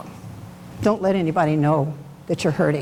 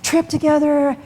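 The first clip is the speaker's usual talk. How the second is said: higher, gushing imitation of a happy wife; gushing lady; 7 semitones higher than usual. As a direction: mimic her bubbly bragging lightly. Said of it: "and she said".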